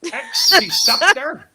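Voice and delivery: high, laughing singsong. Real, not acted. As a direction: laugh out the goodbye. Laughing at friend.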